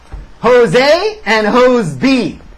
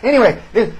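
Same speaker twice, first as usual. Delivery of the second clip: usual speaking voice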